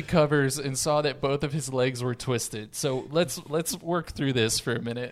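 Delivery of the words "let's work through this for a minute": usual speaking voice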